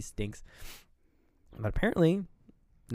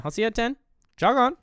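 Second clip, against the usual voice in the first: high-pitched send-off, louder on the last words; mock dismissive; 11 semitones higher, 5 dB louder, faster than usual. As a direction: imitate a breezy brush-off, voice pitched right up. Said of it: "She's, like, dismissed her".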